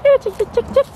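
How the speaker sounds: high sing-song call to hens; calling chickens. Real, not acted. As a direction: call the chickens over brightly.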